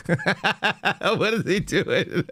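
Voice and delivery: slow, high, through laughter; can barely get the words out for laughing; laughing disbelief. Real, not acted. Host baffled by the post, laughing.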